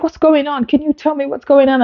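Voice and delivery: high, fast, anxious questions; retold panic. Patient asking the sonographer, high and panicky. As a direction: pitch the questions up fast, in rising alarm. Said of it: "And then I started panicking".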